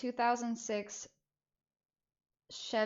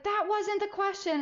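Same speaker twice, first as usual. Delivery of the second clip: much higher, sharp retort; stern mom; 12 semitones higher than usual. Retold or imitated. Mom to stalling kid, sharp higher retort. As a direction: cut the dodge off sharply. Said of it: "And she's like".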